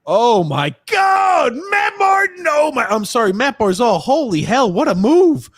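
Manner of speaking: real high, loud outburst of amazement; excited shouting. Real, not acted. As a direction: shout it like a fan stunned by a great move. Reacts live to Barzal's move.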